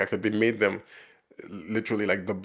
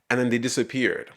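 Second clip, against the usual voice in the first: normal talking voice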